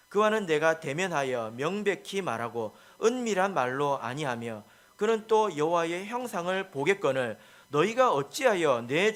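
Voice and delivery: loud, slow congregational Bible reading; measured, full-voiced recitation of scripture; scripture read-aloud. Real, not acted. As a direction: lead the room in reading the verse aloud, loudly and deliberately. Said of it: "같이 읽겠습니다. 시작".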